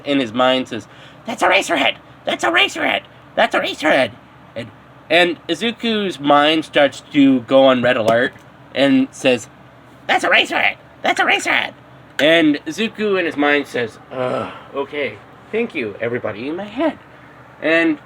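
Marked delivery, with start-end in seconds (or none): 1.2-4.1 very high fanboy squeal, repeated; excited squeal. Acted. Clones in his mind shouting. 10.0-11.7 very high fanboy squeal, repeated; excited squeal. Acted. Mind on red alert. 14.1-17.0 softer, quick, fed-up mumble; annoyed mutter. Acted. Izuku annoyed at inner voices.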